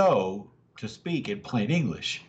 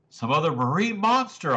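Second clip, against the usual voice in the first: higher, a touch of alarm; alarmed cry; 6 semitones higher, 3 dB louder than usual. A narrator's reading. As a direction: blurt it out, mildly alarmed. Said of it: "I cried, already alarmed".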